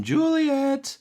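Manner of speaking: high calling out of a name; calls Juliet from another room, pitched high; offstage call. Acted. Voice from within calls Juliet from afar.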